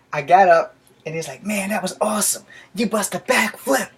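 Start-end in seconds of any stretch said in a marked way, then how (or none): 1.4-3.9 put-on high-pitched awed kid voice; impressed friend. Friend marveling at the fall, excited and high. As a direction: imitate an amazed boy, pitched up. Friend admires the accidental backflip.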